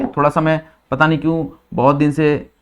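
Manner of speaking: normal talking voice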